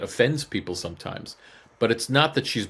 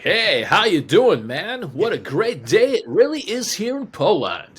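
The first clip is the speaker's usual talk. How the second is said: high, booming, hammed-up American broadcaster voice; exaggerated singsong American enthusiasm; over-American accent; 7 semitones higher, 4 dB louder than usual. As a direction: overdo the cheery American accent like talk radio. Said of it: "they're more American than I am".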